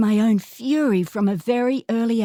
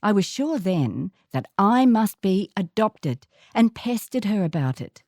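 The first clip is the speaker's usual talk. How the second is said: ordinary delivery, nothing marked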